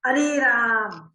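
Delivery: loud, slow अरे अरे राम in wonder; astonished exclamation. Shown as a demonstration. Teacher acting astonishment for the class, loud slow cry. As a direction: cry out अरे राम in amazement, vowels held. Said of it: "ऐसा भी तो बोलते हैं ना".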